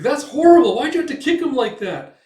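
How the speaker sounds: high, loud indignant cry; outraged scolding. Retold or imitated. Companion scolding Guts for kicking the old man, high loud outrage. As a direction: scold him for the cruelty. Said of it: "It's like".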